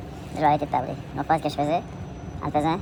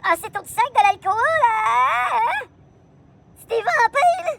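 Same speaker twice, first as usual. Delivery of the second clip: high put-on voice; 13 semitones higher, much slower than usual. High put-on voice, vampires joke.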